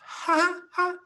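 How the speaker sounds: small high-pitched acted chuckle; light little giggle to oneself, acted; child's chuckle. Game master voicing a small child, high chuckle. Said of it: "and chuckles to herself".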